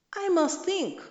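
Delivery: anxious exclaim. A narrator's reading. Kyundu puzzling over two feasts.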